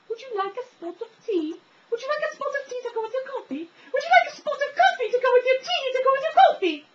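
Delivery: very high, squeaky British lady; hammed-up British falsetto, increasingly loud; blonde gum-commercial woman. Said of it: "blonde chick from the, uh, Orbit's Gum commercials".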